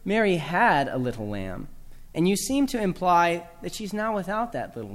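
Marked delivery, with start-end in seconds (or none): 0.1-1.7 "had" leaned on and stretched; stress on HAD. Shown as a demonstration. Teacher implying the lamb is gone, stress on "had". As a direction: hit "had", easing off after it. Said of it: "Emphasize the second word".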